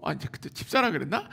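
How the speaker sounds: usual speaking voice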